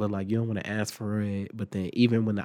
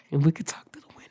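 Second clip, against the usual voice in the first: laughing whisper; 5 semitones higher, much faster than usual. Retold or imitated. Mama joking through car window.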